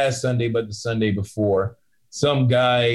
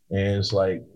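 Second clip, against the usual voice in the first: ordinary talk, nothing marked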